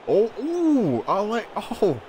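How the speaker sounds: high-pitched, delighted oohs; real excited delight. Real, not acted. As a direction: squeal in admiration at the move. Excited reaction to opponent's move.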